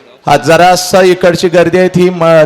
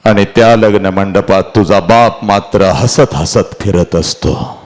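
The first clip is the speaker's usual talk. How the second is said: low, subdued storytelling; hushed, heavy narration; grave oration; 8 semitones lower, slower than usual. Acted. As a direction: let the voice sink and slow at the end.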